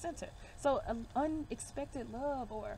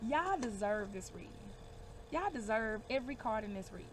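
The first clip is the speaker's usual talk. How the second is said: normal talking voice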